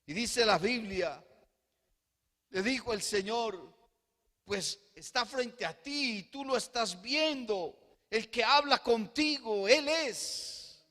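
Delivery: loud, high-pitched impassioned sermon; full-force pulpit fervor; fervent preaching. Acted. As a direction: preach it out to the whole church. Jesus' answer at full preaching force.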